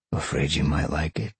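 usual speaking voice

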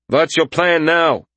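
slow, high and insistent; demanding. A narrator's reading. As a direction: press hard for an answer. Mercenary demands an answer.

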